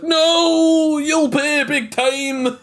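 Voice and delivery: high, loud, then slow and drawn-out vengeful threat; vengeful Scot's final curse while digitizing away; hammed-up villain voice. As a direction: shriek the threat, then stretch it out slowly. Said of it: "it's that voice".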